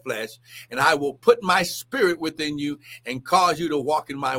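loud, lifted scripture delivery; forceful scripture reading. Acted. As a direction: proclaim the verses.

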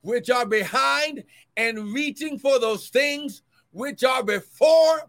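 rising, ringing preacher's reading, slowed on the mark; preaching scripture. Acted. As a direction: proclaim the verse to the congregation.

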